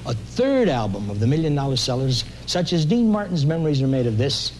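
normal talking voice

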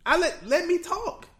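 high-pitched plea for the floor; insistent protest. Real, not acted. Objecting to being interrupted.

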